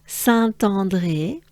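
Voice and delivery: slow "Saint-André" with a clear T opening the second part; careful French liaison on the T of "Saint-André"; linking T. Shown as a demonstration. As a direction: link "Saint-André" as sann-tahn-dray in French. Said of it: "the T is important".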